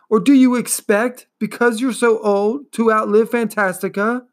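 put-on boy angrily crying out to the turtle; angry cry. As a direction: shout it at her angrily.

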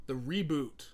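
drawn-out announcer welcome; show host intro. Acted. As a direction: stretch it like a ring announcer. Opening the show to listeners.

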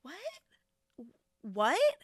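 stunned what. Retold or imitated. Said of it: "And I'm like".

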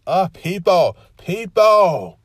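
loud, high exasperated yell at people; annoyed outburst. Retold or imitated. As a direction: shout your irritation at the whole world. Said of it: "And so I'm so annoyed".

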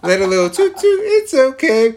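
teasing through laughter; giggling while teasing; laughing. Real, not acted. Teasing friend about farting.

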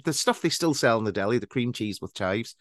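ordinary delivery

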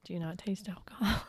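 soft unvoiced whisper. Real, not acted. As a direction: whisper the question. Whispered aside.

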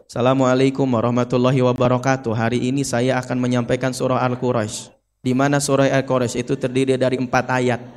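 low, sleepy monotone sermon; no intonation. Shown as a demonstration. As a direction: deliver the greeting without any intonation, like a dull preacher. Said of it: "tanpa intonasi, kira-kira tunuh mah". (ceramah datar tanpa intonasi, bikin ngantuk)

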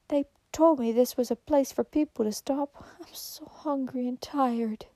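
breathless, exhausted whisper; faint whisper. A narrator's reading. Soaked stranger begging the old man for shelter, whispered. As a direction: barely get the words out, worn to nothing. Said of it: "said the bedraggled woman".

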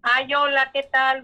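ordinary talk, nothing marked

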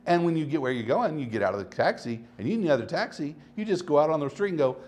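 usual speaking voice

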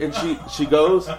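usual speaking voice

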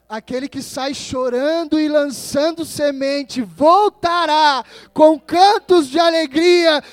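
loud, high, ringing proclamation; full-force preaching. Acted. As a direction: declaim the scripture with force, stretching key words. Declaims the psalm at full force. (proclamação bíblica a plena voz)

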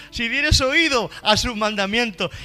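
lectura bíblica aguda y enérgica; predicación a plena voz. Acted. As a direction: proclama el versículo recalcando palabras. Preaches scripture at full force. (prédica fuerte, con pausas enfáticas)

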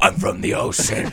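loud, unvoiced rasp; silly character voice. Mermaid switches accents again, loud harsh rasp. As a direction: rasp it out forcefully as a joke.

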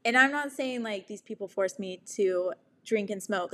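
ordinary talk, nothing marked

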